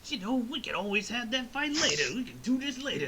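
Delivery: lightly voiced high hippie dude. Super dude suggesting they fight later, lightly voiced hippie. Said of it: "hippie sounding".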